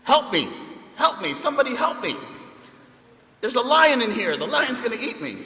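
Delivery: high, loud desperate cries for help; panicked yelling. Retold or imitated. Monkey in the lion's cage shouting for help in panic. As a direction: yell for help like your life depends on it. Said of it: "he started yelling out".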